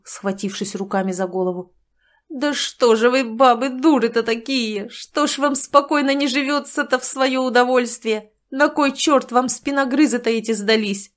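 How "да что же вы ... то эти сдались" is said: louder, exasperated outburst; howling rant. Acted. Sanych rants at women, loud and exasperated. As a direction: wail at women in despair. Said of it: "взвыл".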